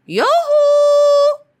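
high, loud yoo-hoo call. Acted. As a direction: call up to someone far above. Deer calls up to the tree.

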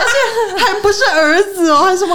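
high, loud laughing disbelief; squealing with laughter at mum's guess. Real, not acted. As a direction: crack up while you talk. Laughing at mom's wild guess.

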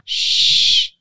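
long voiceless "shh"; hushing shush. Retold or imitated. Mother silencing her child in the elevator, hushing shush. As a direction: hush a child with a sharp shh. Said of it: "那这时候我就会讲".

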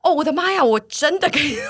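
high, loud burst of amazed excitement; giddy high-pitched rush; excited disbelief. Retold or imitated. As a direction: squeal it out like you can't believe your luck. Excited retelling of being told she can channel.

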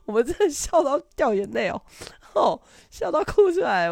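high laughing breathy giggle; laughing to tears. Real, not acted. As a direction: giggle out the words.

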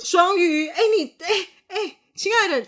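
high, loud, laughing teasing; thrilled cackling praise; excited laughing praise. Real, not acted. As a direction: gush at the partner through laughter.

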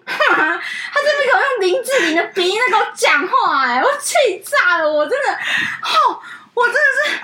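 exasperated laugh breaking through; furious laughing. Real, not acted. Assistant venting to friend about caller, laughing in outrage.

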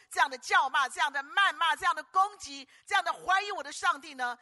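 loud, high, indignant sermon cry; fiery preacher shouting out indignation; preaching at full force. Acted. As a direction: thunder it from the pulpit like outraged David. Preacher voicing David's indignation.

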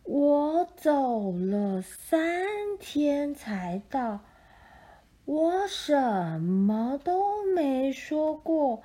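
very slow, drawn-out sloth voice. Acted. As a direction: stretch every word like a sloth. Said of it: "树懒说".

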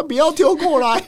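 high, alarmed mock shout. Yelling at stranger not to throw the lighter, mock alarm. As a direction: call out don't throw it, mock panic. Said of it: "我说".

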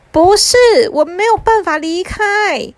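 put-on high, drawn-out child's protest; exasperated little brother. Little brother telling mom he is stuck, high and exasperated. 弟弟着急地反驳妈妈.

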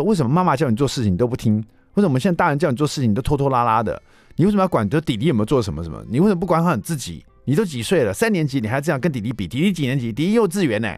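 angry scolding. Retold or imitated. Father reenacting yelling at his son over chores, angry. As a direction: rattle off the scolding in a temper. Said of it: "就噼里啪啦就骂他这样子".

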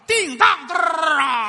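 imitating a huge fart after the pills; mock fart noise. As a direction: trumpet the noise out long.

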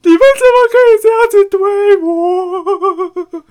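high, loud, fast wailing imitation; hammed-up sobbing wail of betrayal; crying teacher. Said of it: "他就这样子哭". (模仿老师哭喊，又高又大声)

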